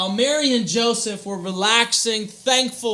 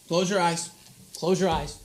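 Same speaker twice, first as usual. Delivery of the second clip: ordinary talk, nothing marked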